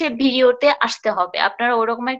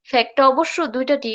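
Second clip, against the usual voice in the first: ordinary delivery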